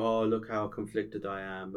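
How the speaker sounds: slow, whiny self-pity parody; mock angst. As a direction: drag it out like a tortured villain. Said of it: "the same thing with Magneto that they've gone to".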